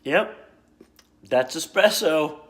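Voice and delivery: ordinary delivery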